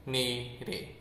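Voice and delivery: usual speaking voice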